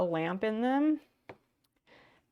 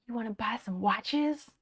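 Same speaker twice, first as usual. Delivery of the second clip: a hint of a sleazy watch seller; lightly voiced shady salesman whisper-pitch; shady trench coat guy. Streamer mimics a shady parking lot seller, lightly voiced sleazy pitch. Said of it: "he's like".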